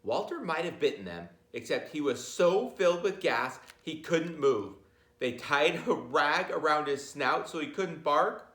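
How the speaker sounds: ordinary delivery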